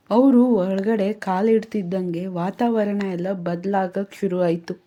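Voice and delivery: ordinary delivery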